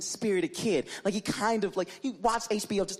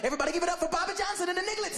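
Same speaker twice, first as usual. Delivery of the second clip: higher, fast emcee intro; mock announcer; 11 semitones higher, much faster than usual. Imagined emcee introducing a band, fast and high. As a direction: hype it like a club host.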